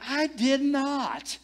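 high, slow, drawn-out protest; mock-offended retelling of a denial; indignant denial. Indignant denial in retold quarrel.

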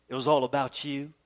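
usual speaking voice

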